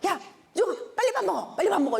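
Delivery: impatient mom at breakfast; hurried nagging. Retold or imitated. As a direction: hurry the kid along impatiently. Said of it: "화를 안 냈어도 급해가지고".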